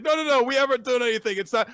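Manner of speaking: high, fast, loud protest; flustered denial. Retold or imitated. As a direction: blurt out a panicked denial. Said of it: "And she's like".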